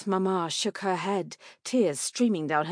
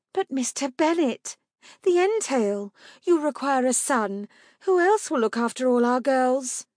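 higher, worried wife; anxious wife; 7 semitones higher, 6 dB louder than usual. Acted. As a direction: plead it up high.

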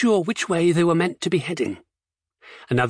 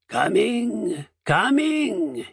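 higher, rough jailer's call; rough, throaty; 5 semitones higher, much slower than usual. A narrator's reading. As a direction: croak it out from afar. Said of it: "his voice rough and throaty".

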